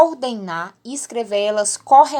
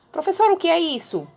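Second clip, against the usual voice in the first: lightly voiced pupil, pitched higher; imagined puzzled pupil; 7 semitones higher than usual. Pupil asking the teacher about a new word, lightly mimicked. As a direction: lift the voice into a pupil's question.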